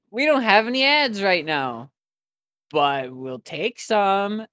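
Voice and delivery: relaxed outro plug; podcast ad read. Acted.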